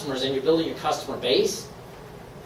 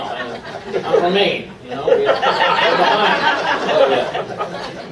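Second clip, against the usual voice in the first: laughing; 3 semitones higher, much slower than usual. Real, not acted. Laughs at being from Maine.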